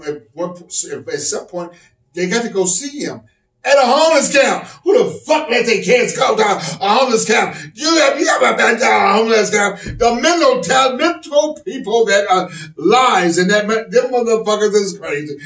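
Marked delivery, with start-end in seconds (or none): 3.7-15.4 loud, higher-pitched comic tirade; shouted, worked-up stand-up rant; outraged rant. Pretend. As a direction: erupt in disbelief and keep hammering. Outraged rant about homeless camp.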